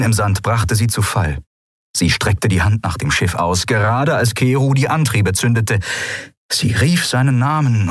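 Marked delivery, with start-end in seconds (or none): none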